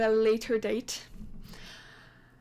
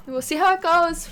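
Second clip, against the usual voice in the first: louder and higher, eager; a loud excited we'll see; 6 semitones higher, 11 dB louder than usual. Real, not acted. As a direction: call it out with anticipation. Rising excitement over the game.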